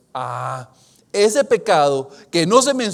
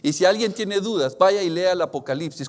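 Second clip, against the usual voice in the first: usual speaking voice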